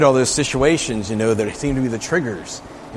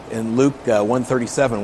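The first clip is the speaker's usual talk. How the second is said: ordinary talk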